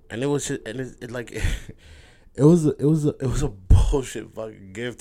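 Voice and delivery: choked-up laughing over a small gift; real emotion pushing the voice up; getting emotional. Real, not acted. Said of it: "and I'm getting emotional".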